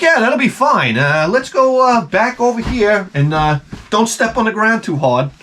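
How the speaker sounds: high, breezy put-on reassurance; overly chipper contractor hiding dread; fake cheerful. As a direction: sound falsely upbeat to dodge the job. Faking cheerful reassurance to the client.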